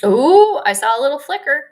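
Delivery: sudden loud hopeful ooh; excited. Real, not acted. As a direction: burst of excitement. Comments seem to return.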